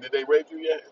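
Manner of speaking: lightly voiced wife asking; mock Martha. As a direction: ask it deadpan as Martha. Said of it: "Martha be like".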